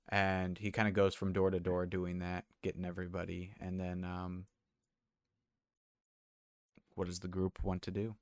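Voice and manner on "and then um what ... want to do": ordinary talk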